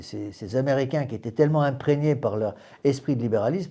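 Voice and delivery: normal talking voice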